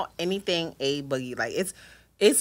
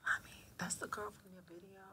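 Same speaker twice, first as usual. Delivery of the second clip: whisper; 2 semitones higher, 21 dB quieter than usual. Retold or imitated. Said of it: "she was like".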